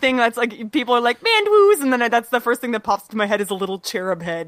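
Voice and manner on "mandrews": cooing name. Retold or imitated. People saying his name.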